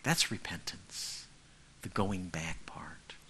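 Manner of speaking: slow hushed whisper; hushed, slow explanation. Real, not acted. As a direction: breathe the words slowly. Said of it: "whispered, slow point".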